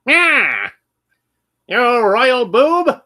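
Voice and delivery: high raspy skeleton villain voice; sneering skull villain yell; Skeletor impression. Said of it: "I can do my Skeletor impression".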